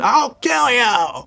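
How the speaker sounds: high, loud drawn-out yell; mock scream. Homeless man screaming after the fleeing woman, high acted yell. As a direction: act out a screamed threat, slow and high. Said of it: "He's screaming".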